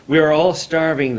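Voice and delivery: loud, desperate cry. A narrator's reading. Starving villagers crying out in despair, loud. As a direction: wail it out to the sky. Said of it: "the villagers cried".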